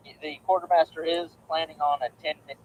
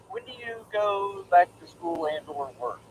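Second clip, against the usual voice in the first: normal talking voice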